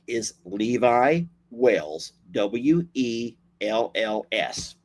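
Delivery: said slowly, each part clear; slow clear name. Shown as a demonstration. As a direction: spell the name out slowly for viewers. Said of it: "His name".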